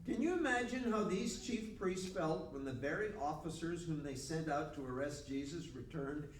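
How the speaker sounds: usual speaking voice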